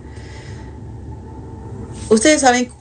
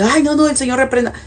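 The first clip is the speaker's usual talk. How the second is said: quick, higher mock gasp of alarm; shocked listener; 6 semitones higher, faster than usual. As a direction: act out a churchgoer recoiling in shock. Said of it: "Y tú me dices". (el oyente escandalizado, en tono de alarma burlona)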